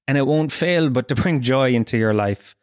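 ordinary delivery

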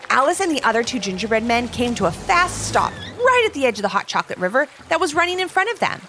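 plain reading voice

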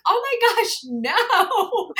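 high, loud laughing denial; laughing reassurance. Retold or imitated. Boyfriend reassuring her it is no breakup, high laughing denial. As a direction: quote the boyfriend brushing off her fear, laughing. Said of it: "He's like".

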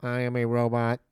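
stiff robot voice. Pretend. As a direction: speak like a robot. Robot talk.

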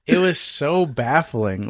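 drawn-out, baffled recollection; slow amazement. Real, not acted. Recalling the odd waiter exchange to friends, slowly amazed. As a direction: stretch it out, still amazed.

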